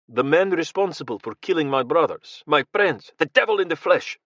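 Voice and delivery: a rising angry flurry of words, pitch climbing; angry outburst. Acted. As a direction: spit it out at the man who killed your friends. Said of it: "the anger spilling forth from him".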